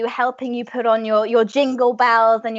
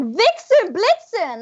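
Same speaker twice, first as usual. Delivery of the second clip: high-pitched frantic calling out; acted panicked parent cry; 9 semitones higher, slower than usual. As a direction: call for your missing kids. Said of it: "looking for their kids frantically".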